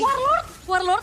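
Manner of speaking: high, loud and drawn out; urgent excited call. Real, not acted. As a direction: call it out slowly.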